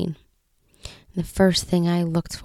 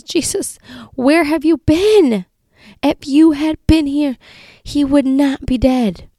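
high, loud, pleading cry at Jesus; anguished cry; 8 semitones higher, 6 dB louder than usual. Retold or imitated. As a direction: cry out to him in grief and reproach. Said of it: "they're yelling".

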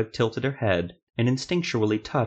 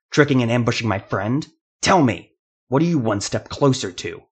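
rising fury, louder and louder; angry confrontation; 2 semitones higher, 5 dB louder than usual. Acted. Jeremiah demands answers from the dying rebel, building to a furious shout. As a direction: spit the accusation at him.

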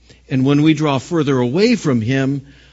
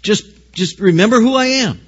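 raised, insistent higher-pitched appeal; God urging; 6 semitones higher, 4 dB louder than usual. Acted. Preacher voicing God reassuring people facing a hard place, insistent urging. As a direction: urge them on with rising intensity. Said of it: "And so He says".

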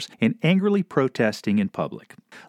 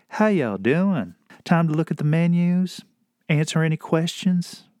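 put-on southern server accent; 4 semitones higher than usual. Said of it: "our server had this accent".

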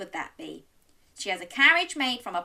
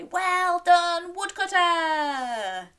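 high, loud, drawn-out group cheer; 10 semitones higher, 4 dB louder, much slower than usual. Pretend. Storyteller leading children praising woodcutter, long high cheer. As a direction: sing out the praise slowly.

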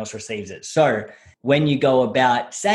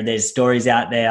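normal talking voice

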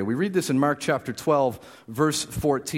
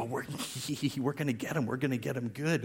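softer, giggling little schemers; a hint of giddy kids plotting a trap; giddy children; 9 dB quieter, faster than usual. Preacher voicing plotters as giddy children, with a soft laugh. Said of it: "almost like little children thinking".

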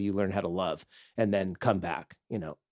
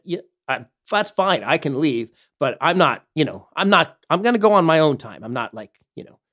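raised, higher-pitched defiant pushback; defiant retort; 5 semitones higher, 7 dB louder than usual. Retold or imitated. Replying to the owner who told them to leave, defiant. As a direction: stand your ground, refusing to be bossed. Retells defying order to leave.

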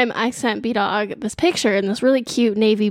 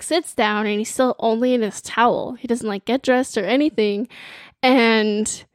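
ordinary delivery

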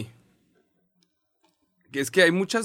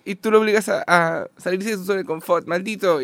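high, loud whiny mock voice; 9 semitones higher, 6 dB louder than usual. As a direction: read it like a whiny fan. Reading mock message in a voice.

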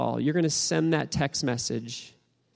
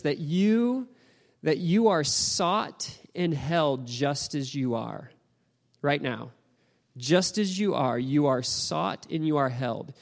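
slow, deliberate sermon delivery; emphatic preaching; 3 semitones higher, slower than usual. Real, not acted. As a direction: let each phrase land slowly as an assurance.

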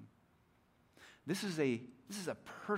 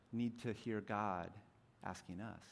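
ordinary delivery

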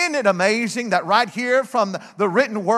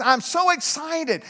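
higher, drawn-out student excitement; retold excitement; 6 semitones higher, slower than usual. College student tells the preacher his news, retold excitement. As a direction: imitate an eager student, pitched up. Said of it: "he said this".

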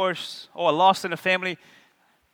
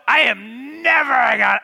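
mock outburst; 3 semitones higher, 4 dB louder than usual. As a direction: blurt it out like a hammed-up rant. Mocking a negative confession.

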